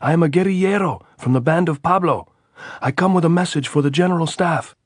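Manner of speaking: high, loud answer across the wire; shouting. A narrator's reading. Andres answering the sentry's challenge, shouting. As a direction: yell it up so they hear. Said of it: "Andres shouted".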